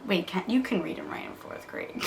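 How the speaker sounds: whispered self-correction under her breath; whispered aside. Real, not acted. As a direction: drop to a whisper to correct yourself.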